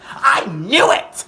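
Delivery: high, loud, drawn-out shout; yelling. Retold or imitated. Friend's mom catching them in the room, yelling. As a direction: yell it slowly in triumph, imitating an angry mom. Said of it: "like, fucking yelling at me".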